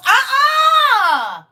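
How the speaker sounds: loud high-pitched squeal. Real, not acted. Reacting to therapists watching.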